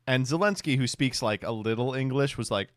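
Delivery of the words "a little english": normal talking voice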